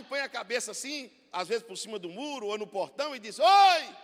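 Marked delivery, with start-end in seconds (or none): 3.4-4.0 high, loud "Oi!" over the wall; loud answering call. Retold or imitated. Mother answering the neighbor over the wall, loud high call. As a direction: call back to the street.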